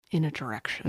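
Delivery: unvoiced, dropping whisper; ominous whisper. Warning listeners of dark times, whispered. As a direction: hush it like a ghost story.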